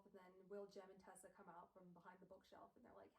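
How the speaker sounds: usual speaking voice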